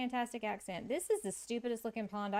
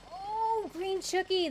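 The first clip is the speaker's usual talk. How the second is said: much higher and louder, thrilled; delighted squeal; 11 semitones higher, 7 dB louder, slower than usual. Real, not acted. Delighted at a chat message.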